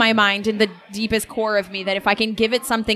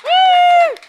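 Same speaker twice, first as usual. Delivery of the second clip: long whooping "woo"; high, loud cheer; 8 dB louder than usual. Real, not acted. Player cheers the smashed rock, long loud whoop.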